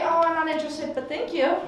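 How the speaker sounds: mock-sweet rejection to a creep; polite brush-off. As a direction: turn him down sweetly, up high. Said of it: "I say".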